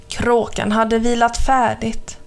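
ordinary delivery

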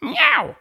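a high meow; cat meow. Acted. The cat meows before repeating herself.

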